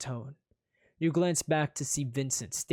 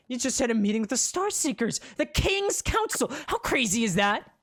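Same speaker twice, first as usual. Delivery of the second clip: giddy excitement; 10 semitones higher, 5 dB louder than usual. A narrator's reading.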